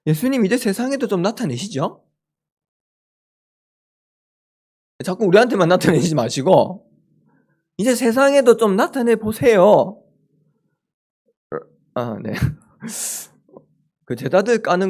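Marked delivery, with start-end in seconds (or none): 0.1-10.0 a touch of brash cheek, slowing on the last plea; cheeky demand. Retold or imitated. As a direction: nag Jesus like a cheeky student. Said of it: "버르장머리 없이 다시 재해석해서".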